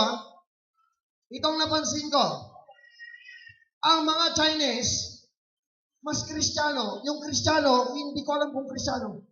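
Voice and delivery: high-pitched, projected sermon delivery; forceful preaching. Real, not acted. Preacher comparing Chinese business owners with Christians, forceful preaching. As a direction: lift the voice to drive the point home.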